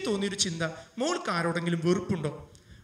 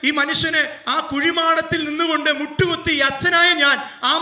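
fervent preaching; 6 semitones higher, 9 dB louder than usual. Acted. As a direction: declare it from the pulpit. Preaching his resolve.

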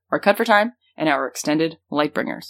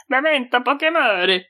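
put-on weird, higher-pitched Italian accent; 7 semitones higher than usual. Co-host defending the weird voice, hammy Italian accent. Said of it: "I'm doing an Italian accent".

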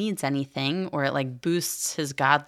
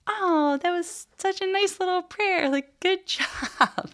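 very high, sweet doting voice, slowing down at the end; patronising God; slower than usual. Retold or imitated. Imagined God praising a little prayer in a very high, doting voice. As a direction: pat a child on the head, high and sweet. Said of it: "God like".

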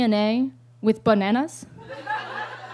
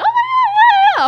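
squeaky high mock agreement, louder; mock squeal; 7 dB louder, slower than usual. To her girlfriend, a sarcastic high-pitched yeah yeah. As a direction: squeal the yeahs in a cartoonish high voice. Mocking agreeing to astrology joke.

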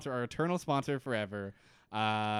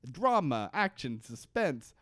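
Brooklyn huckster pitch, louder at the end; Brooklyn accent; 4 semitones higher, 4 dB louder, slower than usual. Acted. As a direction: sell it like a Brooklyn barker. The accents that we are doing.

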